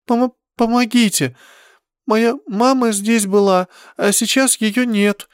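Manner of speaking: high, pleading cry, stumbling start; a lost child begging strangers; fluttering cries for help. Acted. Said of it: "Хотелось кричать".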